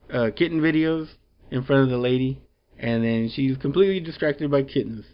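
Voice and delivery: usual speaking voice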